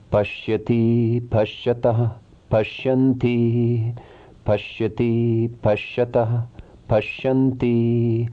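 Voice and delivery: even, measured chanting of verb forms; rhythmic recitation. Shown as a demonstration. Teacher reciting Sanskrit verb forms for students, in a measured chant. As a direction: recite each form in a steady rhythm, like a class drill.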